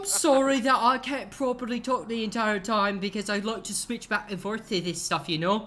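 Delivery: high, loud, hammed-up mock-British accent; wandering British accent. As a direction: apologise in an accent sliding toward Cockney. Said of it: "like, almost Cockney".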